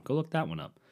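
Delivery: ordinary delivery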